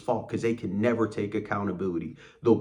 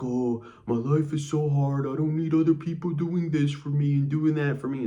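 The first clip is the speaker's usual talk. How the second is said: mock self-pity; 7 semitones higher, 3 dB louder than usual. Boss giving you a speech about his hard life, mock self-pitying. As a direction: make fun of someone pitying themselves. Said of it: "self-pitying speech like".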